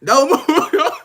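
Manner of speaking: high, hammed-up movie quote of a tough guy lining people up; P from Baby Boy. Quoting P lining them up, mock tough command. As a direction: bark the order like P in Baby Boy. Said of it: "when P lined them up and said".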